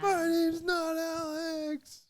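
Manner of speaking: very high, slow strained whine; loud hammed-up high-pitched plea; mock tortured captive. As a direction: imitate a torture victim's drawn-out wail. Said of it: "As he's getting tortured".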